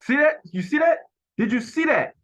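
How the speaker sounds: high, loud, urgent pointing-out; excited exclaim. Real, not acted. Viewer spotting the key moment in the clip, high excited call. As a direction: jump on it, you just caught it.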